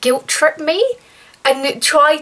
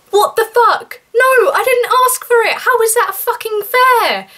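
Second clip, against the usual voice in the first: outraged cry; 10 semitones higher than usual. Real, not acted. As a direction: cry out in real outrage at the unfairness. Outraged at being pressured.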